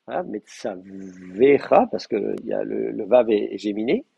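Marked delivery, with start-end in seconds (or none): 0.2-1.9 metsavecha stretched very slowly, the vav held doubled; slow demo word. Shown as a demonstration. Teacher reminding students of the dagesh, very slow. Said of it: "n'oubliez pas de mettre le dagesh".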